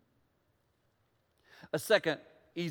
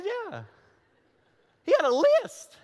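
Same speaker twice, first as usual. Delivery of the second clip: amazed; 14 semitones higher, 6 dB louder than usual. Real, not acted. Amazed retelling.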